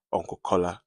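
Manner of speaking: plain reading voice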